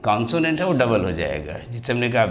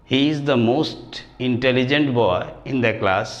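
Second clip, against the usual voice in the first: slow example reading; much slower than usual. Shown as a demonstration. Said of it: "teacher reads example sentence".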